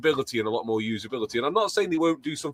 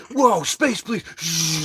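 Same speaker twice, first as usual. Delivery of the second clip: higher, louder mock kid at play; excited kid; 4 semitones higher, 4 dB louder, much slower than usual. Kid playing in an imagined advert, excited. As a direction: exclaim like a kid playing spaceships. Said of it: "some kid going".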